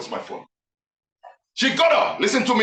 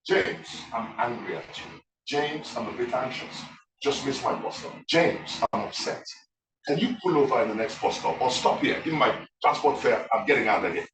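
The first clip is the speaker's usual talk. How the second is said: low, soft and measured, acted calm; 6 semitones lower, 6 dB quieter than usual. Passenger telling the driver she is upset, calm. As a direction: voice the passenger calmly naming feelings, low and quiet. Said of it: "she could have calmly walked up to the driver".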